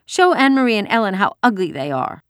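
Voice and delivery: put-on high, bossy little-girl voice; commanding child. A narrator's reading. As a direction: order them about like a cross small child. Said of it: "she commanded".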